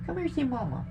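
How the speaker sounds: high, soft pet talk; coaxing the dog. Real, not acted. Owner calling the dog to Mama, softly coaxing. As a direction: coax a pet over gently.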